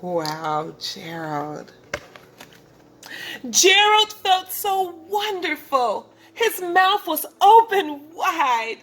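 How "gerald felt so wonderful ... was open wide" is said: higher, joyful storytelling; excited narration. A narrator's reading. Reading to children about Gerald dancing, higher and joyful. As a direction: share the thrill of the moment.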